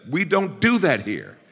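high, ringing pulpit exhortation; fervent preacher's appeal; preaching at full force. Real, not acted. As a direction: drive it home to the congregation.

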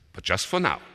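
loud, high caveat; firm neighbour. A narrator's reading. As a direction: give in, but set a firm condition. Neighbour sets a condition.

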